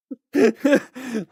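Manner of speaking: sudden high embarrassed laugh; high burst of laughter. Real, not acted. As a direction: laugh out loud at yourself, high-pitched. Embarrassed laugh at own story.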